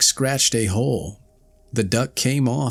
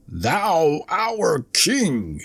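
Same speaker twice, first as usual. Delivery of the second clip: slow, scornful bird chorus, only a bit higher; mildly voiced angry birds; still more angrily; 7 semitones higher, much slower than usual. A narrator's reading. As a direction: sneer at the claim, slowly. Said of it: "still more angrily".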